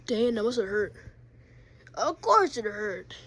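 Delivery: normal talking voice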